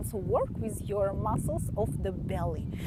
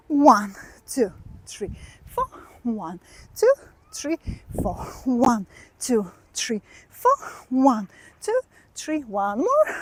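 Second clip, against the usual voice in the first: loud, punchy dance-class count; counting the beat; 4 dB louder than usual. Shown as a demonstration. Dance teacher counts students through the moves. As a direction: call each count to the class.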